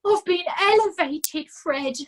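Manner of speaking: milking his misery; moaning. A narrator's reading. Nobby to Fred about being made a lord, moaning. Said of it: "moaned Nobby".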